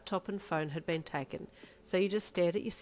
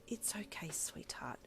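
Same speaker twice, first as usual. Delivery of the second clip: soft comfort; 10 dB quieter, slower than usual. Acted. Mum comforting child.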